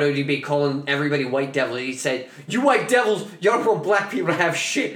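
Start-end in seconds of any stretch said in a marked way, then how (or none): 2.5-5.0 high, raised mock-outraged rant; freaking out. Rapper accusing studio staff over champagne, imitated freak-out. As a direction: imitate an indignant tirade. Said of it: "he started freaking out".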